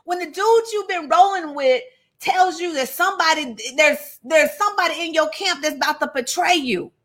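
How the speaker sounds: high-pitched, incredulous rush of words; baffled and high, as if stunned on their behalf. Real, not acted. Teacher wondering aloud about betrayal at the table, incredulous.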